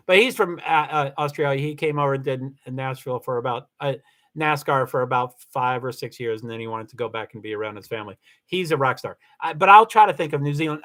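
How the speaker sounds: usual speaking voice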